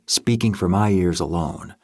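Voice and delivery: usual speaking voice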